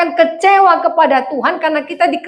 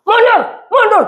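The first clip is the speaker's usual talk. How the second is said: angry parking attendant; 7 semitones higher than usual. Retold or imitated. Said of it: "dimarahi tukang parkir".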